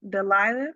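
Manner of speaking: "Delia" as de-LIE-a, questioning rise; unsure name pronunciation. Shown as a demonstration. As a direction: mispronounce "Delia" as de-LIE-a, asking. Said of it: "not sure how to pronounce your name".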